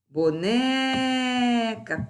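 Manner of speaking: "Boneca" with a long, high "ne"; stressed syllable drawn out. Shown as a demonstration. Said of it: "penúltima".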